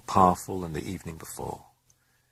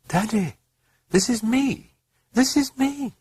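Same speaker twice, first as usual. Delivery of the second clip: put-on high little girl pleading; child's voice. A girl calling to her father, in a high child's voice. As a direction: call out high and small, like a lost child. Girl's voice heard in memory.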